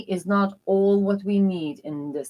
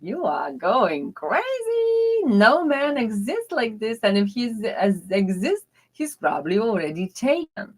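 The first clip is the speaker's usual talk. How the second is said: friends teasing; 5 semitones higher than usual. Retold or imitated. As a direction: play her friends laughing off her dream. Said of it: "my girls, like, friends, was like".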